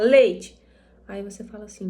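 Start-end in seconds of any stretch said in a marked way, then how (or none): 0.0-0.6 loud one-word blurt; child demanding. Retold or imitated. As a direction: imitate a child's abrupt, loud request. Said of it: "se a criança fala". (imitação de criança exigindo, bem alto)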